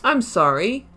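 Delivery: ordinary talk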